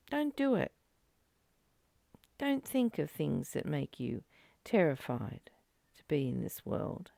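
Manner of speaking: usual speaking voice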